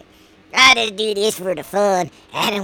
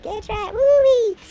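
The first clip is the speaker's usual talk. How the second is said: high excited woo-wee; excited whoop; 12 semitones higher, slower than usual. Real, not acted.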